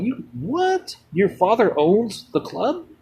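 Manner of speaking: a shocked double take at the news; stunned disbelief. Retold or imitated. A shocked reply to his friend Tony. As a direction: pitch jumps high on "You, what?", then slows in disbelief. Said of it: "I was like".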